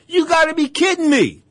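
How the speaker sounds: disbelief. Retold or imitated. Retold shock at bigger opponent.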